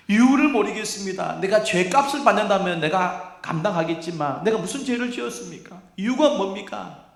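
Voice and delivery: high, loud, indignant protest; lashing out. Acted. Sufferer demanding reasons from God, loud high protest. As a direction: fire bitter questions up at heaven. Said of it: "막 하나님 앞에 쏘아붙입니다".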